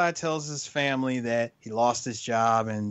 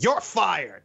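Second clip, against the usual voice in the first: mock boss yell; 5 semitones higher than usual. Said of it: "this is the part I was telling you about".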